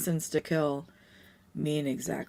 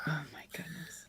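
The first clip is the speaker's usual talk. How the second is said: hushed, shocked whisper; 13 dB quieter than usual. Real, not acted. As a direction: breathe it out in disbelief. Shocked aside at orphan mines.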